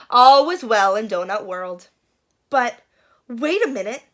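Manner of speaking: ordinary delivery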